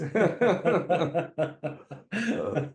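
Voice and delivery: big high cackle; loud high laugh. Real, not acted. Laughing at own punchline.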